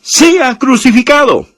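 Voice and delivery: high, slow mock cry of the crowd; crowd shout. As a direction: cry it out like the mob demanding it. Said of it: "ellos gritaban aún más diciendo".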